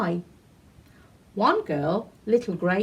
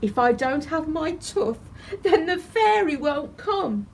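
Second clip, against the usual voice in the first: put-on glum child; 7 semitones higher than usual. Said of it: "looked ever so glum".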